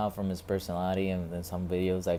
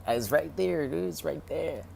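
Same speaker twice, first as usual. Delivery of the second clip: exasperated pointing out; 9 semitones higher than usual. Retold or imitated. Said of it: "It's like".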